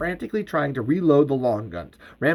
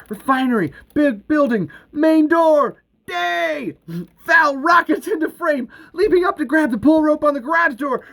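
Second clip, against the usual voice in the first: higher-pitched, breakneck excitement; excited action narration; 9 semitones higher than usual. A narrator's reading. Narrator as Val races to the door, excited. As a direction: drive the action forward urgently.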